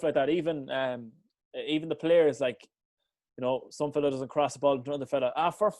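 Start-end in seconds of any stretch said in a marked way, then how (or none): 5.4-5.7 frustrated player. Retold or imitated. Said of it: "you can hear on the camera".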